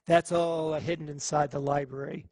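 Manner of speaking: ordinary delivery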